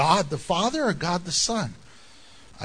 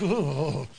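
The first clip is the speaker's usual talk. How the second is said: low, drawn-out mock groan; frustrated groan; 5 semitones lower, 4 dB quieter than usual. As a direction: groan in playful exasperation. Acting frustration as they walk away.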